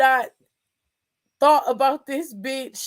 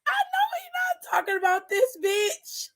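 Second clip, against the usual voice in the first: very high, thin, softer squeal; squealed disbelief; 11 semitones higher, 5 dB quieter than usual. Retold or imitated. Retelling her reaction to what he said, squealed disbelief. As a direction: squeak out the outrage near tears.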